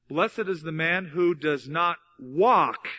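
slow build to a punched "walk"; stress on WALK. Shown as a demonstration. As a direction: set "walk" apart and hit it hard. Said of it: "emphasize different words in it".

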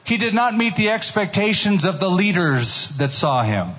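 ordinary talk

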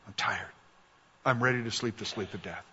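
soft, low, exhausted confession; weary despair. Acted. Preacher voicing a worn-out believer, soft and drained. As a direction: let it sink, too tired to go on.

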